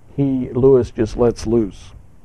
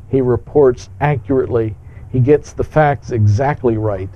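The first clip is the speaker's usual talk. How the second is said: usual speaking voice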